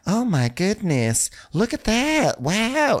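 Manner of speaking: very high, drawn-out admiration; high-pitched put-on swooning; gushing girl. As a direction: coo in mock amazement. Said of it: "And the girl's like".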